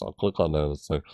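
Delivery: usual speaking voice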